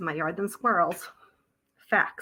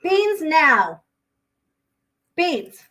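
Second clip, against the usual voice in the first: sharp loud high call of the dog's name; 8 semitones higher, 6 dB louder, much slower than usual. Real, not acted. Owner calling her dog Beans inside, loud and high. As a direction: call her in firmly, now.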